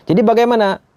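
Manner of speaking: usual speaking voice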